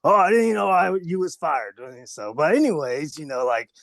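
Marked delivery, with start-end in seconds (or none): 0.0-1.8 lightly voiced boss, higher and louder at first; a hint of a big boss, starting high; Vince McMahon. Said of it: "go thank Vince McMahon".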